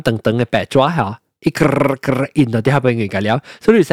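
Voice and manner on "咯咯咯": rolled rattling printer sound; trilled "krr-krr" of a machine; printer noise. Retold or imitated. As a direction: imitate a receipt printer running. Imitating the receipt printer's noise.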